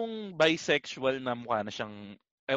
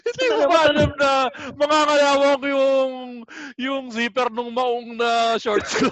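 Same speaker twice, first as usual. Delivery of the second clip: higher, louder whiny mock voice; lightly voiced sarcastic girlfriend; 9 semitones higher, 8 dB louder than usual. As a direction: play the complaining girlfriend sarcastically.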